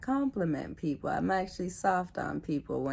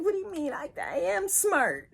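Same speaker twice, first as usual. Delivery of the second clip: much higher, protesting squeal; mock indignant self; 13 semitones higher, 4 dB louder than usual. Imitating her own hurt reply to attackers, in a high indignant voice. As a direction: play her younger self, offended and squeaky.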